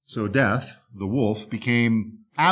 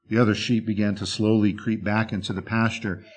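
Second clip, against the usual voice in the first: ordinary delivery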